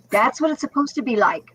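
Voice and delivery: usual speaking voice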